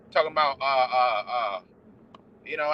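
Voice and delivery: usual speaking voice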